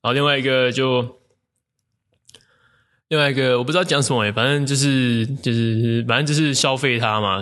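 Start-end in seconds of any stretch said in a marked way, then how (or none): none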